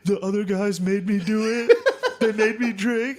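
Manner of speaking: put-on high, whiny tearful kid voice; mock sobbing. Drunk son blaming others to his mom, mock sobbing. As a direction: wail the excuse, half laughing. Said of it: "I was sobbing".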